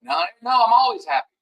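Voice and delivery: brightly mocking always-great voice; mock relentless optimism. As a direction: parody a fool who thinks all is fine. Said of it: "You know".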